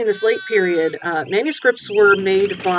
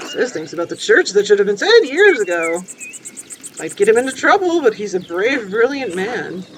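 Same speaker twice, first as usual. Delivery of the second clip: higher, keen fan of a preacher; eager admirer character; 6 semitones higher, faster than usual. Acted. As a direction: press the question eagerly, then praise with conviction. Voices game dialogue.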